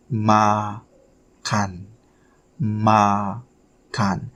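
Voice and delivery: "makan" drawn out as "ma... kan"; syllable by syllable. Shown as a demonstration. Teacher sounding out a new Indonesian word for learners, syllable by syllable. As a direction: split "makan" slowly into two syllables. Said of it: "Make sure you".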